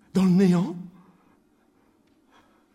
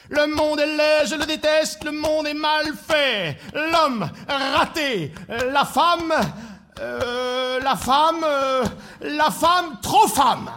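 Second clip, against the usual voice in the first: high, droning rant with drawn-out words; insistent high-pitched grumbling litany; gloomy griping; 6 semitones higher, slower than usual. Acted. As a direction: chant complaints, stumbling on euh before the punchline. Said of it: "les pensées noires".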